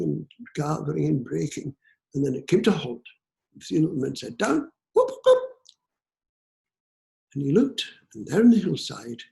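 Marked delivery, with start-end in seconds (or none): 4.4-5.4 high, loud playful whoops; whooping call. A narrator's reading. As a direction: call down, then whoop sharply upward. Little men call out dismounting.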